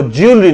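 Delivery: ज्वेलरी as "jyool-ree", two syllables; y glide after j in ज्वेलरी; common mispronunciation. Shown as a demonstration. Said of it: "वो ज्वेलरी नहीं है".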